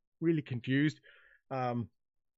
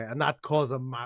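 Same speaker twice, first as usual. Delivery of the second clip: normal talking voice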